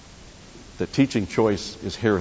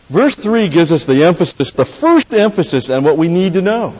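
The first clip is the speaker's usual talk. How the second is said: higher and louder, pressing the point; forceful sermon emphasis; emphatic preaching; 8 semitones higher, 9 dB louder than usual. Acted. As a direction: proclaim it to the congregation.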